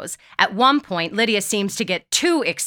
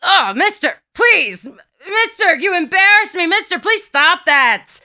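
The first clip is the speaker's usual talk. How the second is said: high, loud scandalized plea; shouting down; 11 semitones higher, 7 dB louder than usual. A narrator's reading. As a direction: shout down in embarrassed protest. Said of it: "he begins to shout down".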